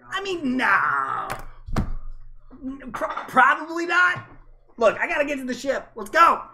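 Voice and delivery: very high, loud squeaky critter; high creature voice. Acted. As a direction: squeal it out like a frantic glider. GM voicing Squawks.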